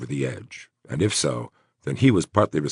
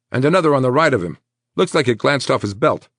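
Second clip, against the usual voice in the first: higher, louder, quick report; brisk paramedic; 9 semitones higher, 5 dB louder, faster than usual. Acted. Paramedic reporting after a quick examination, brisk and raised. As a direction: rattle off the injuries briskly.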